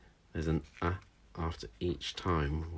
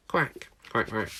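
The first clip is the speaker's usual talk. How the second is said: duck quack; 3 semitones higher than usual. Shown as a demonstration. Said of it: "go quack like a duck".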